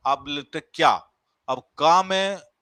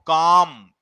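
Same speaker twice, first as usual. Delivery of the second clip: काम said louder, with the का part pressed hard and the end lighter; stress demo; 8 dB louder, much slower than usual. Shown as a demonstration. Teacher demonstrating stress on काम for the class, का punched. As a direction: punch का in काम and let the rest fall. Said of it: "का पे ज्यादा बल दिया और माँ पे हमने कम दिया".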